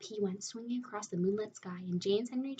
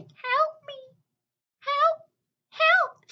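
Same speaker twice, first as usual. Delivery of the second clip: high, slow, far-off calling; distant cries for help; 12 dB louder, much slower than usual. Retold or imitated. Spider imitates her stuck grandmother calling through the night. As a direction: call helplessly from the ceiling. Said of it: "we could hear her calling".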